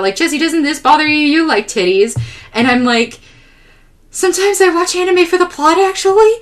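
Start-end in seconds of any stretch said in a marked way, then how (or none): 0.2-2.2 high-pitched, eager mock appeal; enthusiastic dad. Dad appealing to Jesse over anime, over-enthusiastic. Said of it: "he was so enthusiastic about". 4.1-6.3 very high-pitched, acted protest; pained retort. Jesse defending anime taste to dad, high pained protest.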